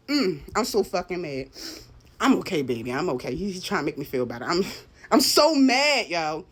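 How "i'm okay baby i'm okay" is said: calm the little one down; reassuring. Real, not acted. Reassuring child who comforts her.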